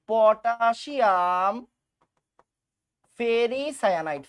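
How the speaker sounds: পটাশিয়াম ফেরিসায়ানাইড drawn out very slowly; syllable by syllable. Shown as a demonstration. Teacher slowly spelling the name for the class. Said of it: "এটার নাম কি ছিল বলো তো?".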